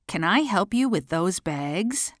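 normal talking voice

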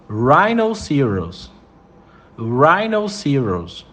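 Rhinoceros drawn out slowly, syllable by syllable; slow model word. Shown as a demonstration. Teacher slowing down so students can copy, careful. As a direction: stretch Rhinoceros out for learners. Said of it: "slow repeated model word".

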